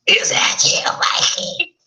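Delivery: put-on breathy, scratchy old woman rasp; raspy whispered old woman. Shown as a demonstration. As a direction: redo it as a croaky hag. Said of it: "so I didn't do that right. It's more like a".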